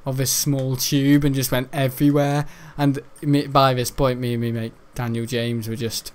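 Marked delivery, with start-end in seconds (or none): none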